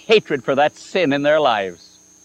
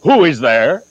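a louder, gruff call through the door; gruff father; 6 dB louder than usual. Retold or imitated. Father behind the door to a stranger, louder and gruff. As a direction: bark it like a wary father woken at night. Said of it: "I heard a gruff voice inside saying".

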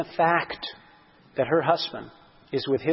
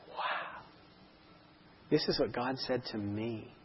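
hushed, breathless wonder; awed whisper; 2 semitones lower, 11 dB quieter, slower than usual. Retold or imitated. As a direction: whisper it in amazement. Awed reaction to his notes.

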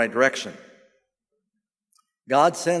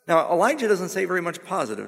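ordinary talk, nothing marked

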